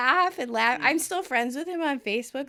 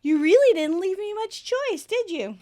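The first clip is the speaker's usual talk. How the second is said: high, nasal-free mock whine rising louder; whining; 7 semitones higher, 4 dB louder than usual. Janice complaining to Chandler about packing, mock whine. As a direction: complain in a needy sing-song. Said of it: "And she whines".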